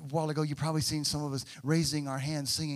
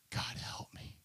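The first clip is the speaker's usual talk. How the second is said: breathless unvoiced whisper to God; whispered plea; 10 dB quieter than usual. David whispering to God for help, hushed. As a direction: whisper it like a desperate prayer.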